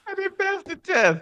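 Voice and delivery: very high, whiny "I didn't pass the test"; mock lament. Agreeing with host's joke, high mock whine. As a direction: squeal it like a wounded loser.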